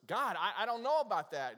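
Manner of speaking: high, loud, stammering protest; hesitant Bob. Retold or imitated. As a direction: play a nervous man balking at the order. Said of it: "And Bob goes".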